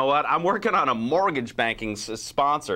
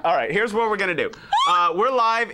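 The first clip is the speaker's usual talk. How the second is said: announcer shout; 6 semitones higher, 3 dB louder than usual. Acted. Host announcing live show.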